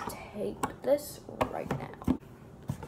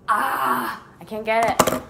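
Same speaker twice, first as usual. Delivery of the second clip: louder burst of frustration; frustrated outburst; 3 semitones higher, 9 dB louder, slower than usual. Real, not acted. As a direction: cry out at the stuck scissors. Struggling to cut with scissors.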